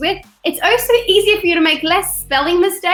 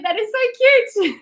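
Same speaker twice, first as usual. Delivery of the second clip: much higher, gushing over something adorable; charmed delight; 7 semitones higher than usual. Retold or imitated. Aunt reacting to her goddaughter, high and delighted. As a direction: melt at the child's cuteness. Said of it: "And I just went".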